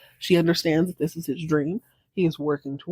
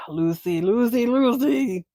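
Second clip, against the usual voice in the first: exasperated; 2 semitones higher, slower than usual. Real, not acted. Exasperated sigh at the character.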